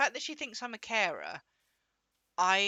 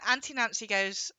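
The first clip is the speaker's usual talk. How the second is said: ordinary talk, nothing marked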